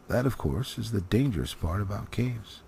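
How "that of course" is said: plain reading voice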